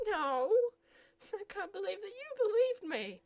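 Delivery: loud high gloating; gleeful mockery. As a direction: crow over the prank. Laughing at his gullibility.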